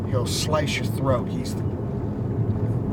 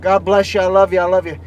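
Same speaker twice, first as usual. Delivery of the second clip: lightly imitated boxer, higher and louder; Mike Tyson; 5 semitones higher, 9 dB louder than usual. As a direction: gush blessings in a borrowed voice. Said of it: "this guy don't talk like that".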